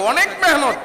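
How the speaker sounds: exclaiming. Real, not acted. Exclaiming about hard work.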